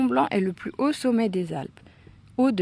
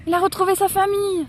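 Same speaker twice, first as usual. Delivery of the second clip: high, loud and delighted; enthusiastic; 12 semitones higher, 6 dB louder, faster than usual. Acted. Girl rejoices as the dog finds its pack, high enthusiastic cry. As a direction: cheer for the reunited dog. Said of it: "s'enthousiasme Maëlle".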